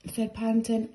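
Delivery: ordinary delivery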